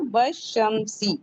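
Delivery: वशमसी drawn out, w joined straight to sh; slow demo. Shown as a demonstration. Teacher to students, slowly modelling वशमसी. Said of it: "अल को नहीं पढ़ेंगे".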